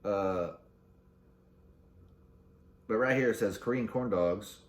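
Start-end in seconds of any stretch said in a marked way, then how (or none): none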